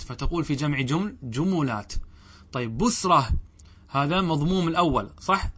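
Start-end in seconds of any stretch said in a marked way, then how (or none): 1.2-1.9 جملات said "jumulāt", u after the m; damma on the mim. Shown as a demonstration. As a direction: say جملات with the m voweled u, echoing the first vowel. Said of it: "أعطي هذه الضمة للميم". (جملات بضم الميم)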